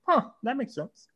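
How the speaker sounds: naive wonder. Retold or imitated. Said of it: "I was like".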